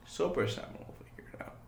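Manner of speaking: fast whispered aside. Real, not acted.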